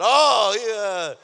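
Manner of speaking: high, very loud exclaimed reply; retold delight. Preacher replaying his answer to classmate's praise, loud delight. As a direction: blurt it out in a mock-elated shout.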